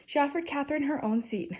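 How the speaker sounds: ordinary delivery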